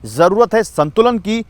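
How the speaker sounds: news report read. Real, not acted. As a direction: deliver it as a punchy scripted TV news story. Reads a scripted news report.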